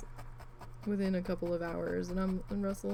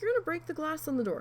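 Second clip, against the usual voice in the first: fast high scolding; 8 semitones higher, faster than usual. Retold or imitated. Yelling at cat.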